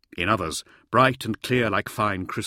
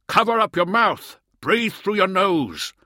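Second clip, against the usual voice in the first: shouting; 11 semitones higher, 6 dB louder than usual. A narrator's reading. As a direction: yell it again, pressing hard. Repeats shout over wind.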